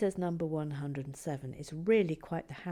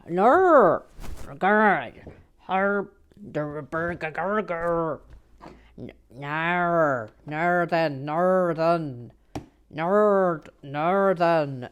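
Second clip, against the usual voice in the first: loud, strained stab at a Northern Irish accent; attempted Northern Irish accent; 10 dB louder, much slower than usual. Shown as a demonstration. Trying Northern Irish for viewer Louise, loud failing Northern Irish accent. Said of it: "I cannot do the Northern Ir".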